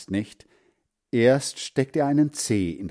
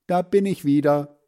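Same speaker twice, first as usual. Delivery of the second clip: higher, louder call to a friend; 7 semitones higher, 5 dB louder than usual. A narrator's reading. Boy returning to his friend, calling out. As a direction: call out that you're back. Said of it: "rief er".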